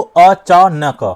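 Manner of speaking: "अचानक" slowly as अ-चा-ना-का, four beats; over-split word. Shown as a demonstration. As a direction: break नक of "अचानक" into two open syllables. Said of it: "इस तरह से तोड़ दिया". ("अचानक" को अ-चा-न-क चार टुकड़ों में बोलें)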